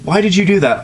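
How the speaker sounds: a hint of a grossed-out young filly; Scootaloo, lightly voiced. A narrator's reading. Fan fiction read aloud, Scootaloo lightly voiced complaining. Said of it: "Scootaloo exclaimed".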